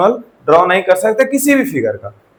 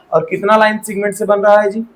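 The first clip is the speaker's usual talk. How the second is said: ordinary delivery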